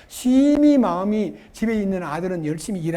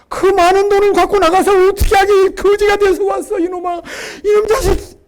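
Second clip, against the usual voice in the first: much higher, shouted, half laughing; hammed-up furious father berating his son; scolding father; 11 semitones higher, 9 dB louder than usual. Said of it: "그 아들을 꾸짖고 있으면요".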